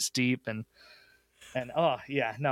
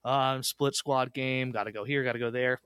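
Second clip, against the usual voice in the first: ordinary talk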